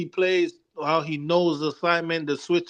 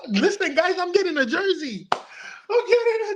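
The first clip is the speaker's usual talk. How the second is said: excited; 13 semitones higher than usual. Real, not acted. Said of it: "excited about getting jersey".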